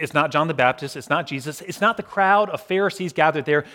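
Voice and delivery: ordinary talk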